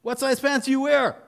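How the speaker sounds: very high, loud drill call; a sergeant calling out names to the recruits; sergeant shouting. Retold or imitated. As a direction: call the roll at full volume. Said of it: "Clothing sergeant calls out".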